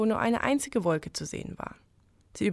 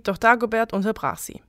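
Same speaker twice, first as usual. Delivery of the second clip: usual speaking voice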